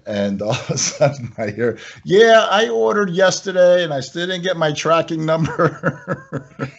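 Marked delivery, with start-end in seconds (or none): 2.0-6.7 high, mock complaining customer voice. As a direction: play the impatient caller, pitched up. Customer voiced on phone call.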